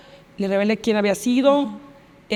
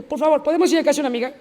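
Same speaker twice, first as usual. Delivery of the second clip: fast, higher-pitched pleading request; urgent plea; 5 semitones higher, much faster than usual. Retold or imitated. Girl asking her friend for a detour, hurried and pleading. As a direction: rush out the retold plea.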